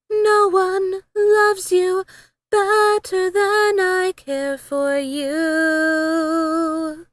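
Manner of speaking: ordinary delivery, nothing marked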